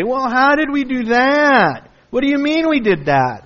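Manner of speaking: high, whiny feigned innocence; mock innocent excuse. Preacher mimicking Israel's excuses, high and whiny. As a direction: play the people dodging blame. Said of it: "and they always say".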